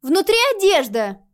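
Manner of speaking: put-on high, loud huffy girl; snorting. A narrator's reading. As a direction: snort it out impatiently. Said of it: "фыркнула девочка".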